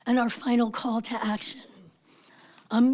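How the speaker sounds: ordinary talk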